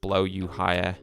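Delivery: steady read-aloud storytelling voice; story narration. A narrator's reading. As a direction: read it like an audiobook chapter. Reads a novel aloud.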